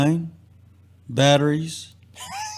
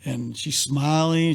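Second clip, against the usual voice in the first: ordinary talk, nothing marked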